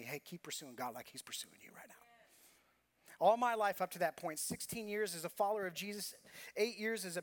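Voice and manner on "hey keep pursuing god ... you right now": softly whispered, lower, like a friend leaning in; whispered encouragement. Retold or imitated. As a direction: whisper it like a confidant.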